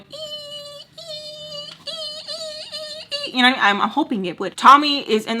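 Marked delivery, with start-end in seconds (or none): 0.0-3.4 very high, soft "ee" whine; thin repeated ringing squeals; tiny high tinnitus tone, much softer than speech; imitated ear ringing. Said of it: "it'll stop going".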